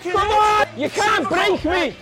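high strained shout, slow on the last words; hoarse marathon cheering. Real, not acted. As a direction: roar through the last reps. Straining through final reps.

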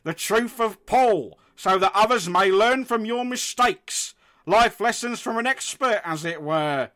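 high, slow booming deity; creation's voice. Acted. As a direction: proclaim slowly from on high. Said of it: "doing the voice".

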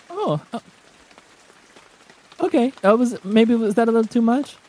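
put-on high, awkward, unsure young girl; flustered girl. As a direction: stammer it out, embarrassed. Baggy flustered by the kiss.

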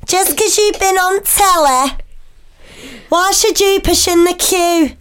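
high, slow Liverpool heckler; Liverpool accent. Acted. Co-host imagines strangers telling off a famous queue-jumper, Liverpool accent. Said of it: "Why are they from Liverpool".

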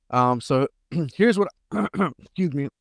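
throat-clearing while choking; choking, clearing his throat; choking on a drink. Real, not acted. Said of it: "fucking choking over here".

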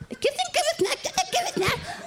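high, chanting mock voice; high-pitched mimic of a man chanting; Iki's sing-song. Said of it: "Han bliver ved".

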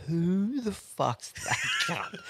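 slow, high, breaking into a laugh; laughing disbelief. Retold or imitated. Seeing his mate with a stranger tucked under his arm, laughing disbelief. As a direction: drag out the question, then crack up.

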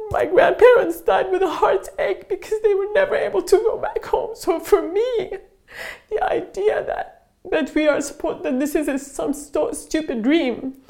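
crying while speaking. Real, not acted. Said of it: "And I'm sorry I'm crying".

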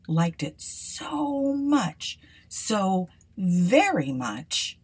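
plain reading voice

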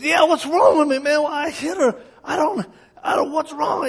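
high, pleading and distraught; whiny distress. Retold or imitated. Husband confessing to the preacher, high whine. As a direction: whimper out the confession.

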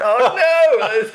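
high, loud cry of dismay with a laugh; hammed-up horror, half laughing; a comic yelp of panic. Realising he is in trouble.

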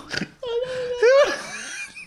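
high squealing laugh; shrieking laugh. Real, not acted. Cracking up at joke.

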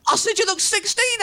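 high, gleeful taunting voice; happy drunk taunt. Retold or imitated. Happy drunk repeating his jibe to Alistair, high taunt. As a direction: pipe it up, pleased with yourself.

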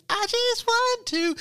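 very high, slow hammed-up wail; mock wail. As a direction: pitch it up into a mock cry. Repeated, wailed very high.

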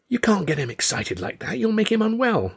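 high, hushed scolding; angry hiss. A narrator's reading. Mother scolding father beside resting child, high angry hiss. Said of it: "she hissed".

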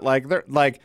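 usual speaking voice

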